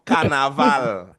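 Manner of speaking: "carnaval" slow, each a an open ah; open vowels. Shown as a demonstration. Teacher models Patois for class, open vowels. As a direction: stretch "carnaval" on open ah sounds. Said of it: "open vowels".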